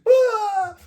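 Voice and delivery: loud, high-pitched wailing sob; hammed-up burst into tears; mock bawling. Beaten tough guy bursts into tears, loud mock wail. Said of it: "he burst into tears".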